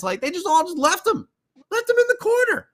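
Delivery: exasperated at teammates leaving him, high incredulous voice; incredulous high outburst. Real, not acted. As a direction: pitch jumps way up in disbelief. Upset teammates left him.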